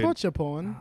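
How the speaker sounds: put-on British accent, r's dropped. Mimicking the British guest speaker, British accent. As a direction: echo it as a British question. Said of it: "I fucking love British accents".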